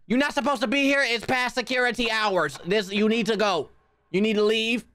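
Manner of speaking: high-voiced officious telling-off; mock security guard. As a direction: order the intruder out in a high voice. Playing security, ordering intruder out.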